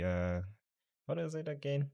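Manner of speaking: ordinary talk, nothing marked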